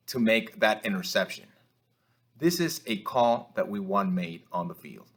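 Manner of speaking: ordinary talk, nothing marked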